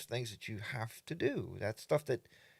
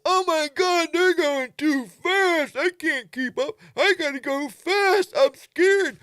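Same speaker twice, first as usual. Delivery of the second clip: much higher, louder, panicky put-on voice; mock scared rider; 10 dB louder, slower than usual. Nervous rider at the back of the group, hammed-up panic. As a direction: play a rider who can't keep up and panics.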